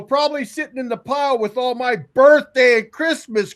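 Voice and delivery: high, loud sarcastic retort; acted-out indignant reply; retold bitter comeback. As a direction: fire back sarcastically. Acts out bitter comeback to dad.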